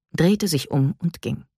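plain reading voice

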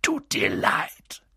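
slow, hoarse bark of disbelief; indignant giant barking the question back. A narrator's reading. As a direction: bark it out, drawn out and rough. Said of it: "bellte Hagrid".

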